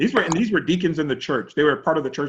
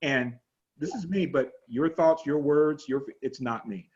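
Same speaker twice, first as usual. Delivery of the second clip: soft, calm inner statement; quiet self-talk demo; 3 semitones lower, 7 dB quieter than usual. Shown as a demonstration. Pastor demonstrates the calm mantra to his class, softly. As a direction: say it softly to yourself. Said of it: "notice the difference it makes".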